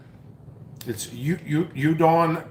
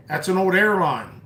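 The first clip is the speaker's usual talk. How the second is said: ordinary talk, nothing marked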